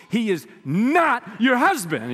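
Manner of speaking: slow, loud talk-show reveal, a hint of Maury; Maury Povich style. Preacher mimics a talk-show host's reveal, mock-dramatic. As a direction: drag out the verdict like a TV host. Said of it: "It's like Maury Povich".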